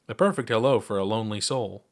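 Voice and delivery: usual speaking voice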